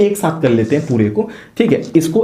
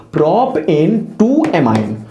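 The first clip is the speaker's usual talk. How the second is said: normal talking voice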